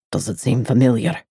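a man soothing her, low and hushed; deep male voice. A narrator's reading. The lover to the girl, low and quiet.

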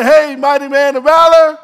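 breezy offhand hey; casual hey mighty man of valor; casual greeting. Shown as a demonstration. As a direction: toss it off casually. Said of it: "He doesn't come up to him and say".

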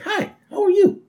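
very high, squeaky little-girl imitation; imitated Lisa. Girl greeting the police chief, very high put-on voice. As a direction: pitch it way up for a mock innocent hello. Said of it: "Is like".

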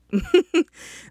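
a quick high burst of laughter; bright high laugh. Real, not acted. Host laughing to listeners about school starting, high laugh. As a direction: laugh it off lightly.

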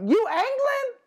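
high squeaky mock question; mocking girl asking. Girl at the band festival asks in a mock squeaky voice. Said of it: "she was like".

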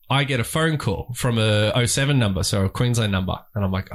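usual speaking voice

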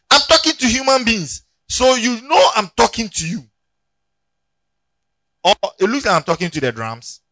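high, loud preaching to the congregation; preacher scolding a sleepy congregation, loud and high; full-force preaching. Acted. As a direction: preach it out hard.

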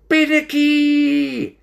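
very high, drawn-out call; loud, long, high holler; stoat's shout. A narrator's reading. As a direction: shout the name in a squeaky voice. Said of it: "shouted Stoat".

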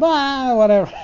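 retold kid mimicking loosely; a light imitation of a yell; mock yell. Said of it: "he's, like, yelling at".

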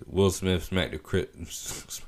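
slow, hushed, spelling out each word in disbelief. Shown as a demonstration. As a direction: say it like you still can't believe it. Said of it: "I thought I would say the words".

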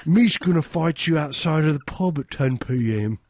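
hammed-up English pub brawler voice; mock English accent. Comedian playing a drunk English brawler in a hammed-up accent. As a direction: threaten like a silly English drunk. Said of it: "It's like".

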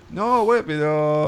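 high put-on Mexican-style accent, hammed-up; mock foreign accent. Host mocks how she switches accent, high hammed-up voice. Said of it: "pone la tonada".

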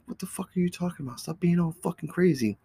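high, quick scolding mom voice; dismissive mom. Retold or imitated. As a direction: brush off the kid's panic fast.

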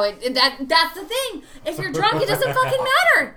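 high, loud outburst rising to a shout; loud heated protest. Real, not acted. Defending muddled accent, heated protest.

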